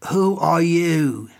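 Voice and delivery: put-on slow, stern old master; stern old man. A narrator's reading. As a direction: challenge the intruder sternly. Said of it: "confronted me sternly".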